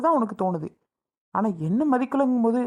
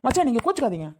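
ordinary talk, nothing marked

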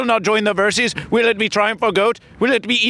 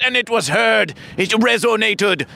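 normal talking voice

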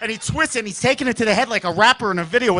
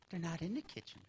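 barely audible, low secretive hint; conspiratorial murmur; 4 semitones lower, 22 dB quieter than usual. Retold or imitated. Little brother hinting at a secret stash, conspiratorial murmur. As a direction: keep it hushed and knowing.

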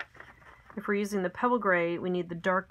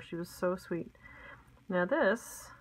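ordinary talk, nothing marked

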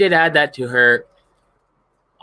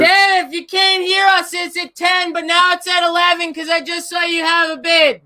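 raised, high voice carrying to the next room; calling out to another room; 10 semitones higher than usual. Real, not acted.

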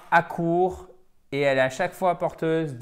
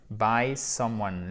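"By someone" said very slowly; slow model phrase; 4 semitones lower, much slower than usual. Shown as a demonstration. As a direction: drag out "By someone" for the class. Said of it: "model phrase in a lesson". (« By someone » très lent)

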